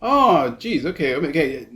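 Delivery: ordinary talk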